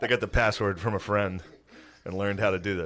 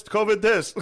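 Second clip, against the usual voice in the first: high pushy repeated sales call; mall kiosk seller; 12 semitones higher, 5 dB louder than usual. Retold or imitated. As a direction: hawk it again like a kiosk seller. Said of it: "they yell at you".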